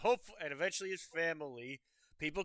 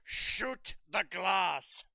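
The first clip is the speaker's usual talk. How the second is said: Alan Rickman; 2 semitones lower than usual. Acted. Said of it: "doing an Alan Rickman impression".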